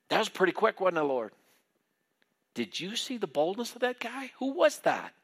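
high, excited bragging voice; thrilled boasting. Retold or imitated. Peter marveling to the Lord at his own boldness, excited and high. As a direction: marvel at yourself, voice shooting up.

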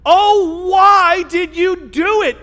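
high, drawn-out dismayed cry; loud groaning. A narrator's reading. Terrified sailors reproaching Jonah, groaning loudly. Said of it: "they groaned".

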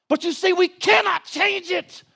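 high, impassioned pulpit shout; shouted preaching. Real, not acted. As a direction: cry it out to the congregation. Preaching at full force.

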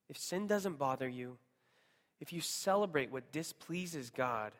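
usual speaking voice

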